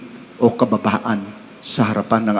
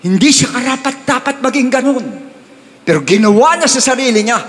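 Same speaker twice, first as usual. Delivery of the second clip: much higher, impassioned preacher peak; fervent preaching; 11 semitones higher than usual. Real, not acted. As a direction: lift the voice high to drive the point home. Preacher's emphatic climax. (masidhing pangangaral nang mataas ang boses)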